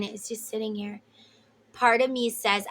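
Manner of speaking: ordinary talk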